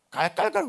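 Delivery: high, mocking spirit's cackle. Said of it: "그러고 웃어요". (조롱하는 귀신의 깔깔 웃음)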